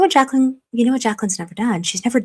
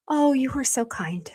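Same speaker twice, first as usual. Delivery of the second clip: ordinary talk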